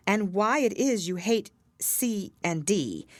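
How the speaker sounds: ordinary delivery, nothing marked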